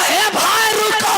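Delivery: slow, high call to stop him; calling out after him. Retold or imitated. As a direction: yell after the fleeing guest. Husband running after guest calling. (भागते मेहमान को पुकारता आदमी, ऊँची खिंची आवाज़)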